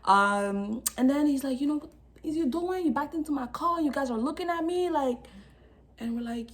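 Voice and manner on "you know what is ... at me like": high-pitched imitation of an irate man; hammed-up complaint, pitched up; mock indignant driver. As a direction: replay the confrontation in a raised pitch. Retelling the driver's angry confrontation.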